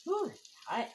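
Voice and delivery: sudden high ooh at the heat; hot food surprise. Real, not acted. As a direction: yelp as the bite burns. Tasting a just-cooked tomato.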